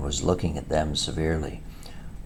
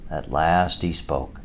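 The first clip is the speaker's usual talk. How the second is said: ordinary delivery, nothing marked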